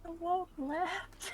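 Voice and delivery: muttered joke. Real, not acted.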